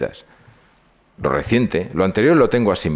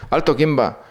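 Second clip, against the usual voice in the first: nervous sentry; 7 semitones higher than usual. Retold or imitated. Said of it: "me apunta un tío temblando y dice".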